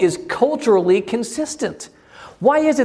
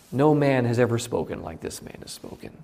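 softer, lower, awestruck reply; awed hush; 6 semitones lower, 6 dB quieter than usual. Acted. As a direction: let the wonder hush your voice. Awed guards answer leaders.

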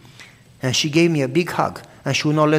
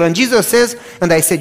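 usual speaking voice

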